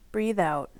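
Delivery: fading exhale count; breathe out count. Acted. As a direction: let the count sink as the breath goes out. Leads a breathing exercise.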